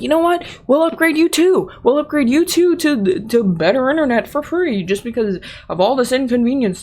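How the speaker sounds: high, eager offer of a free upgrade; upbeat technician. Retold or imitated. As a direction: sell it brightly to end the fuss. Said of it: "the technician's like".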